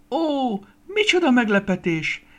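high, loud gasp of amazement; delighted surprise. A narrator's reading. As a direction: exclaim in surprise, pitched right up. Star greets an unexpected newcomer. (meglepett, magas hangú felkiáltás)

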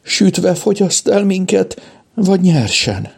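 high, slow whimper; tearful. A narrator's reading. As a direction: dread drawn out in a weepy voice. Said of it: "folytatta sírós hangon Kajtánka".

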